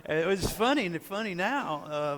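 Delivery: high, loud words through laughter; real laughter spilling into the words; laughing. Real, not acted. Husband finding his heart scare funny now, laughing.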